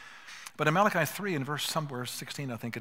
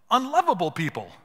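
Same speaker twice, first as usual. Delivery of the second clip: louder, higher punch on the phrase; emphatic; 7 semitones higher, 10 dB louder than usual. Real, not acted. Pastor stressing God loves the unlovable, loud and emphatic. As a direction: drive the point home loudly. Sermon climax on God's love.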